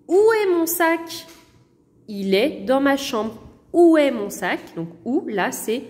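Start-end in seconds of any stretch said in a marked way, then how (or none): 0.1-1.3 teacher's demonstration question; model sentence. Shown as a demonstration. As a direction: ask où est mon sac in a lifted model voice. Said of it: "Et sur le U de où".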